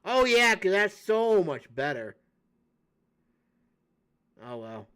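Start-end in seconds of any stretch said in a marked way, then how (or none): 0.0-2.2 sarcastic. Real, not acted. Sarcastic remark on the route.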